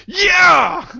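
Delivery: high, loud cheer; imitating excited cheer. Host mimicking Kickstarter backers cheering, high excited whoop. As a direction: mimic a fan's whoop of joy. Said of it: "everybody's like".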